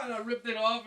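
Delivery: usual speaking voice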